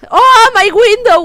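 high, loud, slow alarmed yelp; shocked at what just happened; sudden loud alarm; startled cry. Real, not acted. Startled by broken window.

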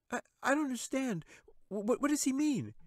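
put-on stammering girl. A narrator's reading. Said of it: "she asked".

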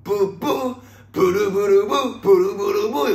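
high, loud mouth-trumpet toots; imitated trumpets. Host mimicking trumpets on a conveyor belt, imitated toots. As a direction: toot and flutter like a brass band. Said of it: "ラッパとか吹いてんねん".

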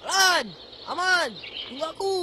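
high shout after departing friends; calling out. Acted. Child left behind calls friends, shouting. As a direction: call for them to wait.

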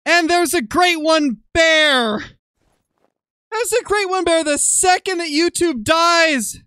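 real high-pitched, drawn-out shouting; high, drawn-out cry of fury; furious. Real, not acted. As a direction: wail it out in exasperated outrage. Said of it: "I'm so mad".

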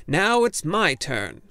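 loud, slow, insistent shout. A narrator's reading. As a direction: finally claim his turn aloud. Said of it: "he shouted".

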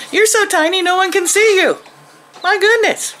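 very high doting coo. Real, not acted. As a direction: marvel at how tiny it is in a squeaky voice. Baby talk to tiny chick.

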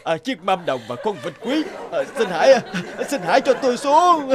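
giọng cao, van nài gấp gáp; cầu xin được thả xuống; van xin hoảng hốt. Acted. Lão chủ quán van xin. (lão chủ quán bị treo ngược van xin tha)

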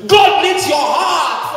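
slow and loud, every word weighted; preacher hammering the point home; slow emphatic proclamation. Acted. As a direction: lean hard on each word.

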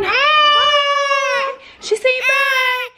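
very high squeaky goodbye. Real, not acted. Baby waving bye.

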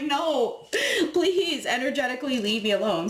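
high, laughing, playful brush-off; mock refusal. You dismissing the clingy ex, high and laughing. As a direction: laugh them off sweetly but firmly.

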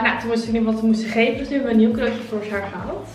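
ordinary delivery

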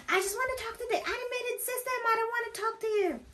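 high, rushed mock-angry squeal; imitated frustrated customer. Customer demanding the automated system, mock angry. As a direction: rattle it off as a shrilly pitched irate caller. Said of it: "she was so frustrated".

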